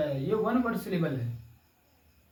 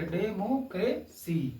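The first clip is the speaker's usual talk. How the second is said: Democracy in four beats, डे, मो, क्रे, सी; separated syllables; much slower than usual. Shown as a demonstration. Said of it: "separate करके समझाने के लिए". (Democracy को चार टुकड़ों में बोलें)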